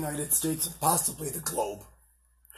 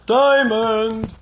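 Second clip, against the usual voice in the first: calling out; 8 semitones higher, 9 dB louder than usual. Real, not acted. Calling the dog loudly.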